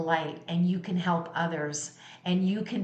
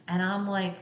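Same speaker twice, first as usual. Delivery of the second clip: ordinary talk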